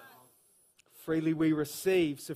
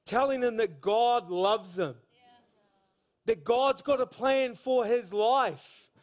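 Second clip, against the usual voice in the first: higher, louder and driving, with slowed emphasis; fired-up preaching; 7 semitones higher, 6 dB louder than usual. Acted. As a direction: preach the story to the crowd with fire. Preaching story at full force.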